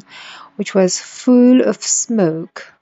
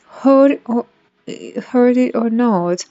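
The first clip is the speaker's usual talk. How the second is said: usual speaking voice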